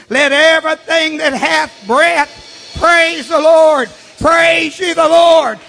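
loud, high shouted praise; preaching at full force. Acted.

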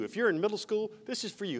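normal talking voice

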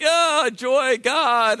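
sarcastic fake praise; a sarcastic "oh joy". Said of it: "I'm like".

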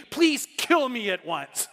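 slow, drawn-out exasperated plea; fed-up pleading. Acted. Moses begging God over the complainers, exasperated. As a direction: beg dramatically, dragging the words. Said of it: "people who complain and complain".

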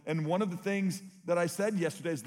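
ordinary talk, nothing marked

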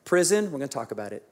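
ordinary talk

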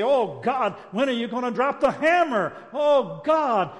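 high, loud mock cry for judgment; mock zealot cry. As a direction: ham up the self-righteous call for wrath. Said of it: "And we say".

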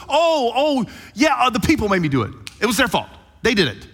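high, whiny excuse-making; blame-shifting Saul. Retold or imitated. Saul making excuses to Samuel, high whiny blaming. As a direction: whine it like a kid blaming others. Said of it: "He goes, ... He does the blame thing".

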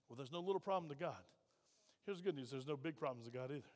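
low, hushed comforting aside; gentle reassurance. Real, not acted. Pastor answering a troubled member, soft and low. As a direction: drop right down and soothe.

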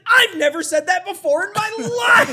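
high, loud retold exclamation; giddy disbelief. Gavin answering friends about his new pickup line, giddy shouting. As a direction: blurt it out, thrilled with yourself.

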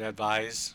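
"advise" drawn out with a z sound; slow "advise", said as the verb with a z; misspelling read out. Shown as a demonstration. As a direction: stretch "advise", ending in a buzzed z. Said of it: "She spelled advice wrong".

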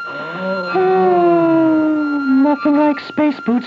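very high falsetto lady; falsetto woman voice. Acted. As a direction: squeak it like a woman. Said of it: "reading woman psychiatrist lines".

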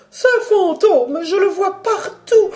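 rising panic, voice cracking with fear; hysterical terror. A narrator's reading. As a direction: baroness gesticulating, frantic about the ghost. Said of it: "proférant un cri de terreur".

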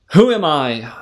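usual speaking voice

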